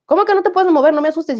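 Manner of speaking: mock-alarmed questioning. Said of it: "el otro asustado".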